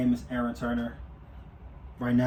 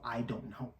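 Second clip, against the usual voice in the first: quiet shrug; 11 dB quieter than usual. Retold or imitated. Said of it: "And I go".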